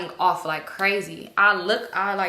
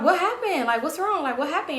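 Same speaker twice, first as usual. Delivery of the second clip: startled questioning; 7 semitones higher than usual. Retold or imitated. Startled, asks what is wrong.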